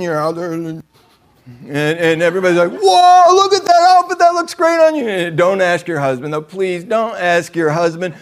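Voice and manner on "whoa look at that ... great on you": high, loud, gushing compliment; hammed-up crowd fawning over clothes; mock gushing admirers. Friends admiring a woman's new outfit, high loud gushing.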